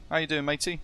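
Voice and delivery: usual speaking voice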